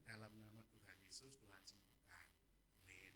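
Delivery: very soft, low healing prayer; hushed prayer. Retold or imitated. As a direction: pray discreetly under your breath. Retelling whispered prayer over patients. (berdoa lirih dan rendah)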